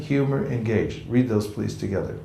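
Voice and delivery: usual speaking voice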